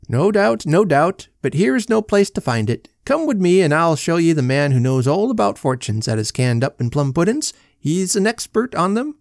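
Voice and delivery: high-pitched Irish policeman, lamenting; officer's voice. Acted.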